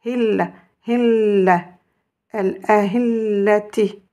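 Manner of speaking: Hil redoublé, le l appuyé avec la shidda; insister sur la shidda. Shown as a demonstration. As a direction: doublez bien le l de al-ahillati. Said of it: "je dois insister". (insistez sur la shidda de al-ahillati)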